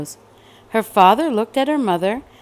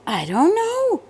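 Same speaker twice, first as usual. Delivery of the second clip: mother's voice; 9 semitones higher than usual. Acted. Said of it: "said her mother".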